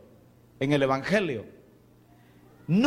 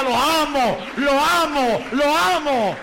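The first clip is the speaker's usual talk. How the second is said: louder, higher loving lo amo repeated; fervent declaration; 6 semitones higher, 6 dB louder, slower than usual. Shown as a demonstration. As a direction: say it like a heartfelt vow to Jesus.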